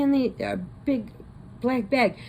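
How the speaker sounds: ordinary delivery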